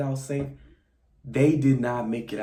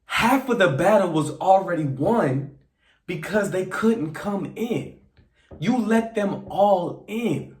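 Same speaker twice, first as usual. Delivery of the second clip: exasperated; 7 semitones higher, 4 dB louder than usual. Real, not acted. Ranting at Grace's choice.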